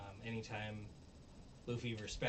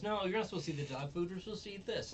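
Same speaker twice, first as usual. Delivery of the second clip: scolding master; 9 semitones higher, 5 dB louder, faster than usual. Retold or imitated. Master scolds dog over food.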